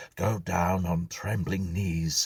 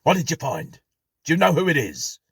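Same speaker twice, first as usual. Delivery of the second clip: much higher and louder, urgent and afraid; fearful impatience; 11 semitones higher, 10 dB louder, faster than usual. Acted. Jackson pressing Drayton for the answer, fearful and urgent. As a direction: demand the answer, scared of it. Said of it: "with fearful impatience".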